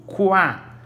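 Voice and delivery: "Quá" high and drawn out, KU-A; U pronunciado. Shown as a demonstration. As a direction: exaggerate the U in "Quá". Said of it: "eu ouço o som do U". ("Quá" agudo, com o U bem audível)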